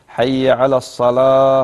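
slow hayya ala as-salah, last vowel held long; adhan call. Shown as a demonstration. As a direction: call to prayer with a drawn-out ending. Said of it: "هكذا".

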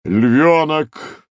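resolute call. Acted. Rhino turning resolutely to the lion cub, firm call. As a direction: call him sharply, decided. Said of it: "решительно обернулся он к львёнку".

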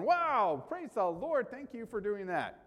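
hammed-up joyful praise; mock praise. Pastor imitating the grateful reply he didn't give, mock joy. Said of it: "I didn't jump up for joy".

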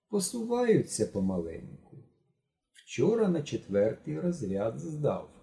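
understated bragging; offhand. A narrator's reading. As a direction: play it down coolly. Korovin boasts casually.

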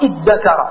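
"إذ ذكر" as iddakara, one doubled d; final merged form. Shown as a demonstration. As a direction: say "إذ ذكر" with no dh, just long d. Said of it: "وأدغمت في الدال".

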